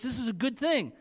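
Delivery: put-on a touch of a child lecturing his parents; insistent child. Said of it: "he thinks".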